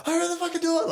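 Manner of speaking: very high desperate yelp; acted panicked high cry; full panic. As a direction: demand it frantically, pitch shooting up. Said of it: "the full panic".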